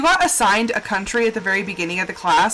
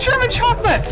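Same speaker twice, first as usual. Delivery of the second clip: cheery announcement; 11 semitones higher than usual. Retold or imitated. Coworkers announcing the cake flavour.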